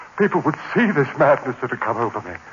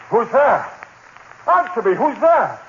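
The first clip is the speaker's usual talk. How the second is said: high, tense call into the dark; frightened call; 13 semitones higher than usual. Acted. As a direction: challenge the unseen figure, fearful. Alone in dark room, sees figure.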